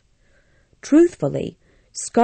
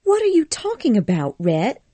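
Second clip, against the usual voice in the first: higher, quick, mildly irritated question; a little annoyed; 7 semitones higher than usual. Acted. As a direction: Scarlett faintly annoyed with Rhett, fast and high. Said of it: "a little annoyed".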